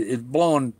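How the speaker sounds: ordinary delivery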